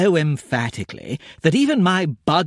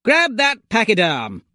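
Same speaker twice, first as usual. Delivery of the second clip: higher urgent shout at the lad; 6 semitones higher than usual. A narrator's reading. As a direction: bark the order, alarmed. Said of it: "I shouted at the lad".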